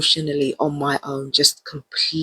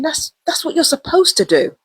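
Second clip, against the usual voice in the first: exasperated thought; 12 semitones higher than usual. Retold or imitated. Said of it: "and I'm thinking".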